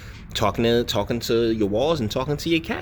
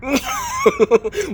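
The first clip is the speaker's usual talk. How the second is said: a much higher, loud burst of laughter; shrieking laugh; 5 dB louder than usual. Real, not acted. Host mocking the Green New Deal, shrieking laugh. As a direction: howl with laughter at the idea.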